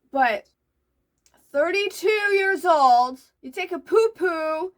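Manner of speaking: ordinary talk, nothing marked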